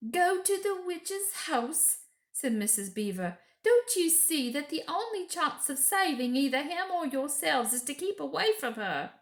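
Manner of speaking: high, fussy motherly beaver wife; a busy animal mother scolding kindly; Mrs Beaver. Acted. Mrs Beaver warning the children, high and urgent.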